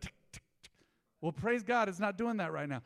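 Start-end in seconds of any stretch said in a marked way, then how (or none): 0.0-0.7 soft unvoiced clock ticks; quiet clicking ticks; whispered tick. Clock in Peter Pan.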